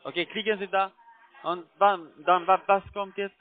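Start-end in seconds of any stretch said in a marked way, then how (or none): none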